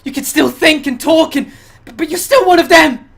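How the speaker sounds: high, loud, stammering alarm; frantic, suspicious survivor; panicked accusation. Acted. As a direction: fling a frightened accusation through the door. Frightened survivor argues through door.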